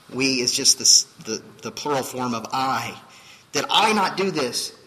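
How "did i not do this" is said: loud, higher indignant protest; the claimants' angry protest to Jesus; angry claim. Acted. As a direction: demand it back with indignation. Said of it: "hear the anger in their voice".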